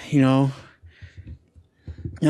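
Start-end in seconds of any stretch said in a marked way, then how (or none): none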